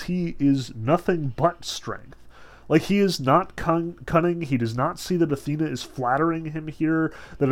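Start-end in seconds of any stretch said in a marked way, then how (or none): 1.6-2.2 breathy whispered stress on strength; whispered emphasis. Shown as a demonstration. Teacher whispering strength to make the point about Zeus. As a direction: drop to a whisper to land strength.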